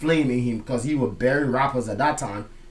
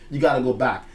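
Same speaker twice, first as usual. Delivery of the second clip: ordinary talk, nothing marked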